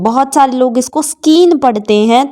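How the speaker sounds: normal talking voice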